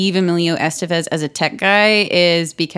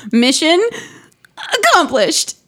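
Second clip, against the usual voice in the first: exaggerated movie catchphrase; mock movie delivery; 10 semitones higher than usual. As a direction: hold back the second word, then lift it high. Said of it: "it's just how it was delivered".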